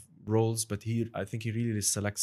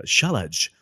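ordinary talk, nothing marked

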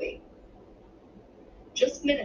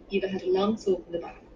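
usual speaking voice

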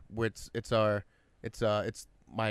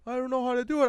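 high-pitched helpless protest; helpless reply, imitated; 11 semitones higher, faster than usual. Confused family member answering at checkout, helpless. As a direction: whine it higher, as the confused relative.

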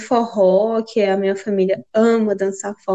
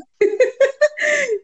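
high-pitched burst of laughter. Real, not acted. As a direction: break into a giggle. Laughs mid-sentence.

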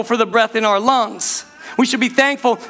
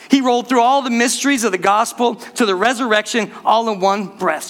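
usual speaking voice

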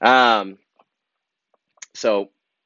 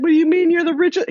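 much higher, louder mock disbelief; high, loud incredulous "richest"; incredulous squeak; 6 dB louder than usual. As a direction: squeak it in fake surprise. Voicing the reply in the retold talk.

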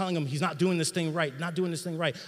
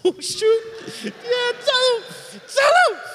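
much higher and louder, cheering; excited shout; 7 dB louder than usual. Retold or imitated. Dad on the sideline cheering the coach on, shouting excitedly. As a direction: yell from the sidelines.

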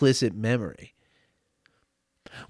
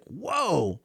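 mild surprise; 4 semitones higher than usual. Retold or imitated. Seeing himself from outside.

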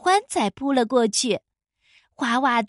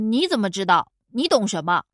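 low-pitched, dismissive challenge; disbelieving; 6 semitones lower, faster than usual. A narrator's reading. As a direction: throw doubt back in a much lower voice. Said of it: "花袜子不相信地说".

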